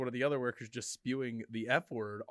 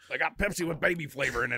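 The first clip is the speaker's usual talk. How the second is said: mock conspiracy claim; 4 semitones higher, 6 dB louder than usual. Mock conspiracy line, far voice.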